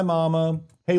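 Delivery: ordinary delivery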